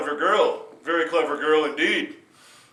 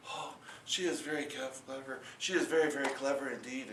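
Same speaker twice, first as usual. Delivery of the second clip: much softer, humble reply; a nervous farmer, eager to please; meek farmer; 2 semitones lower, 9 dB quieter than usual. Acted. Farmer answers meekly.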